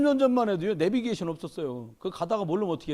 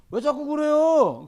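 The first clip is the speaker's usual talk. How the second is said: put-on slow, higher whiny kid voice; sulky kid; 8 semitones higher, 6 dB louder, much slower than usual. Teen at retreat answering the pastor back, slow whiny retort. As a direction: talk back like an annoyed kid.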